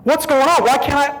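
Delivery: rising high, frantic questions; panicked inner cry. Retold or imitated. Man recalling his soul leaving, crying out in panic. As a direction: panic building as the soul slips away. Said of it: "I thought".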